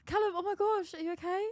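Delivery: high-pitched mock concern; worried visitor. Visitor walking up to a hospital bed, high mock worry. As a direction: greet the patient in high, fussing alarm. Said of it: "You walk in. Go on".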